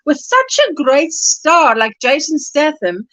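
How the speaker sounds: ordinary talk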